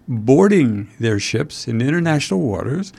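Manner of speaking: ordinary talk, nothing marked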